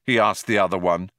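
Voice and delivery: plain reading voice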